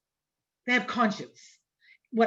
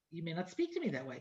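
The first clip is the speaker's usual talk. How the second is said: softer, fast, with a laugh; firm rebuke; 6 semitones lower, 12 dB quieter, faster than usual. Retold or imitated. Mother retelling her rebuke to a teen, softer and laughing. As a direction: redo the rebuke quickly, half laughing. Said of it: "I said".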